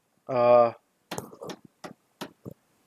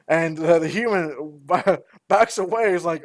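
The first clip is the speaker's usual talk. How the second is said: usual speaking voice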